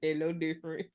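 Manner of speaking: half to herself, quietly; soft aside. Real, not acted. Repeated remark on Aquarius men.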